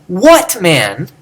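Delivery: what punched high before man; stress on what. Shown as a demonstration. Teacher testing an odd emphasis, stress on what. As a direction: hit the word what hard. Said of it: "Would you wanna emphasize".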